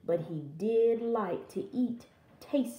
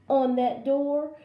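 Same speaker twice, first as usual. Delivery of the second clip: ordinary delivery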